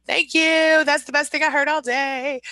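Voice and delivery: light up at the compliment; delighted thanks. Real, not acted. Thanking a viewer who says she looks twenty, delighted.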